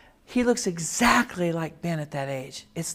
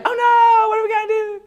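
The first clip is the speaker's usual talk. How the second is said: high, loud wail of dismay; hammed-up tearful panic; mock panic; 9 dB louder than usual. Husband inwardly reacting to surprise pregnancy, mock panicked wail. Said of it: "That's not what I was feeling".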